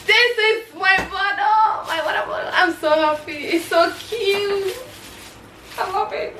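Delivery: high squealy gushing over something cute; gushing delight. Real, not acted. Showing viewers her new water bottle, high gushing delight. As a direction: coo with giddy joy.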